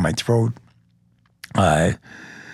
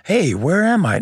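higher-pitched lost-person quote; mock clueless wandering; 8 semitones higher than usual. Officer mimicking what the shooter was not, mock clueless. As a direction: play someone aimlessly lost, pitched up. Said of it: "He didn't come out like".

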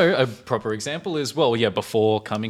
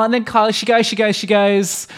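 put-on high, loud Aussie teen girl voice, much higher pitch; Kylie Mole voice; 12 semitones higher, 8 dB louder than usual. Imitating Kylie Mole's Australian accent for a friend. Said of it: "this character's name is Kylie Mole".

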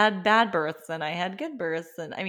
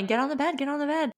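much higher urgent repeated order; mock yell; 8 semitones higher, faster than usual. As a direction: shout it like the staff in a hurry. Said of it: "they were yelling at me".

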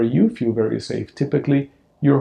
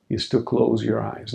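usual speaking voice

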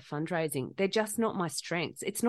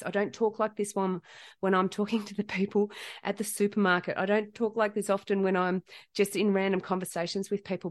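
ordinary talk, nothing marked

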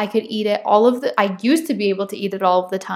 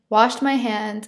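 ordinary talk, nothing marked

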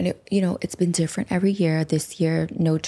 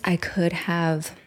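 normal talking voice

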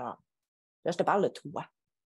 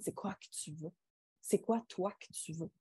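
soft questioning; 8 dB quieter than usual. Retold or imitated. Coach asking client her wants.

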